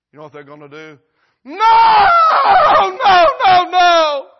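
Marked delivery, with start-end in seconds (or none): 1.4-4.3 very high, very loud wail of no; acted scream of someone waking in the flames; horrified scream. Acts the damned waking in flames.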